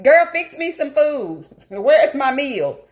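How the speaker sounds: put-on high, slow, demanding little kid; mock toddler demanding her food; bossy toddler voice. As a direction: play a toddler ordering you around. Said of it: "Two-year-old be like".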